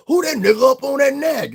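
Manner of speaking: put-on high, loud old-house-servant voice; Stephen impression. Quoting Steven from Django, high and loud scorn. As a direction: sneer it like a scornful old butler. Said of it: "And he go".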